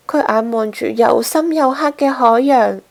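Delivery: plain reading voice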